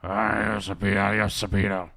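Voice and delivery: lightly put-on movie-hero voice; Indiana Jones. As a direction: drop the pitch for a mock farewell. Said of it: "and Indiana Jones is like".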